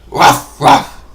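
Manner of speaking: barking like a dog. Shown as a demonstration. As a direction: woof it out like a dog. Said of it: "They're gonna make different animal voice noises".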